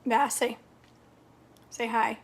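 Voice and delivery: ordinary talk, nothing marked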